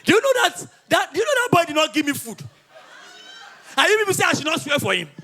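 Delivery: high, loud complaint; indignant outrage. Acted. Storyteller complains boy gave him no food, outraged. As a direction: protest to the crowd, aggrieved.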